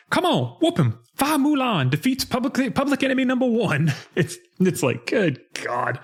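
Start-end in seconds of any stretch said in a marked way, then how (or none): 0.1-3.9 high-pitched imitation of an excited yell; mock yelling. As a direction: holler it high like a hype man, in imitation. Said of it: "just starts yelling like".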